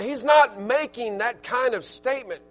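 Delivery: ordinary delivery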